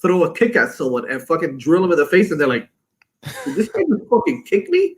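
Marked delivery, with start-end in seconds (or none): 3.5-5.0 high-pitched incredulous question; mock astonishment at normal volume; retold disbelief. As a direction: imitate a stunned opponent, pitch way up. Kicked opponent's reaction, retold.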